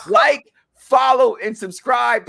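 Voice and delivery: loud, slow call to follow; loud plug. Real, not acted. Host calling loudly on viewers to follow the channel. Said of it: "channel plug".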